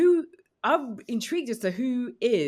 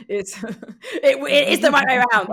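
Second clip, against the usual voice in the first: loud and higher, words breaking into real laughter; laughing through it; 8 semitones higher, 10 dB louder than usual. Real, not acted. Guest cracking up reviewing her cable, laughing while speaking. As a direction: let the laugh take over the words. Laughing at own USB joke.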